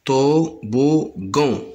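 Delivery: toboggan drawn out slowly; slow syllable demo. Shown as a demonstration. Teacher slowing toboggan for young pupils. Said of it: "Le mot toboggan se compose de trois syllabes".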